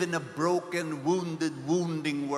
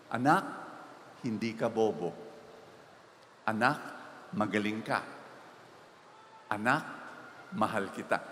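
tender mother; 8 semitones lower, 4 dB quieter than usual. Retold or imitated. Mother consoling failing son.

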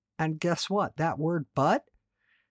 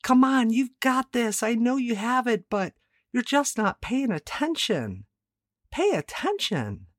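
coaching pep talk; 7 semitones higher than usual. Retold or imitated. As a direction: fire up a distracted player, then scold. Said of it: "did your coach ever say something like".

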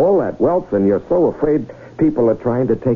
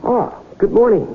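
cheery greeting; 12 semitones higher than usual. Acted. Salesman greets a startled tycoon.

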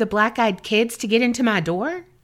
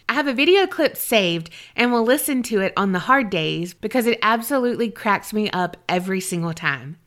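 usual speaking voice

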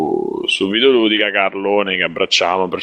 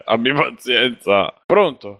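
ordinary talk

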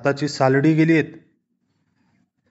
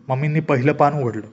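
plain reading voice

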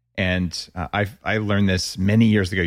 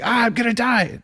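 sudden high-pitched retold panic, much higher; imitating a panicked inner voice; mock panic. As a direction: squeal it like your body thinks it's dying. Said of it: "And then immediately it was like".

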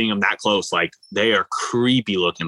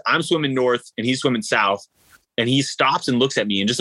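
normal talking voice

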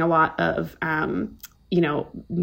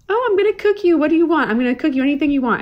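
much higher, sing-song mom voice; eager mom; 8 semitones higher, faster than usual. Retold or imitated. Imitating her mom offering to cook anything. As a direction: play the doting mother promising a feast. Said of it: "My mom was like".

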